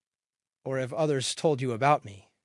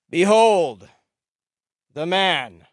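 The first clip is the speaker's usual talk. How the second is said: loud, raised, with a pause midway; grand proclamation; 4 semitones higher, 11 dB louder, slower than usual. A narrator's reading. Pilate presenting Jesus to the crowd, loud proclamation. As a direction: herald him to the crowd.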